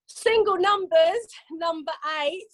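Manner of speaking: slow sing-song number calling; bingo caller. Acted. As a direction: call each number drawn out.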